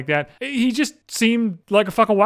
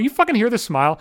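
ordinary delivery

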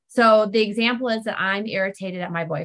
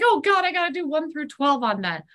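exasperated inner voice; 6 semitones higher than usual. Retold or imitated. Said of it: "I'm like".

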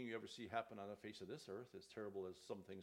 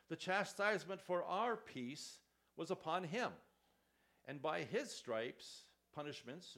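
preaching scripture; 4 semitones higher, 7 dB louder than usual. Acted. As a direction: lift the verses up to the congregation. Reads Isaiah with force.

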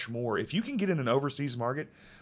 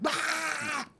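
startled scream; 3 dB quieter than usual. Retold or imitated. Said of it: "walk down the street and be like".

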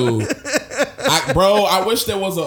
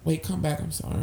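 soft, small pleading voice; imitated sheepish backtracking; meek apology; 7 dB quieter than usual. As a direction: suddenly drop to a quiet plea. Imitates her abrupt apologetic switch.

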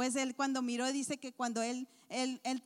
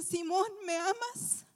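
tender question; 8 semitones higher, 4 dB louder, slower than usual. Acted. Jesus tenderly asks Peter.

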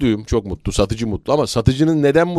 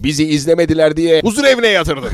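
raised, higher dubbing voice; dubbed-film reproach; 4 semitones higher, 5 dB louder than usual. Shown as a demonstration. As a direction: chide a friend like a dubbed movie character. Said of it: "bir ufak bir serzenişi". (tiz perdeden dublaj serzenişi)

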